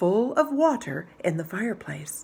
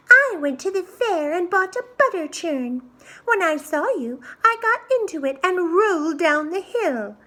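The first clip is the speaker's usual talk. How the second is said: very high, triumphant pig voice; gloating little pig; 11 semitones higher, 3 dB louder than usual. Acted. Little pig laughing at the scared wolf, high and gloating. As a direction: crow at the wolf.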